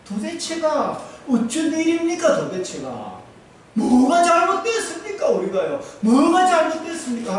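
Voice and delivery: high, loud pleading wail; anguished cry to God. Retold or imitated. Joshua crying out to God after the defeat, high and loud. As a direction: hurl the question up at heaven.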